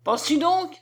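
a touch of outrage; exclaimed. A narrator's reading. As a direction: cry it out in affront. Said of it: "s'écrie-t-il".

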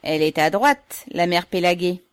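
loud, teasing praise; jovial workman's loud compliment; joking. A narrator's reading. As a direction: tease her so all can hear. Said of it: "lui disaient en plaisantant".

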